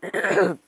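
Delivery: sharp real cough; coughing fit. Real, not acted. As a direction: cough hard into the mic. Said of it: "J'arrête pas de tousser".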